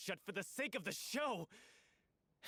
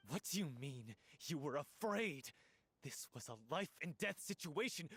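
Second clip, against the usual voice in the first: ordinary talk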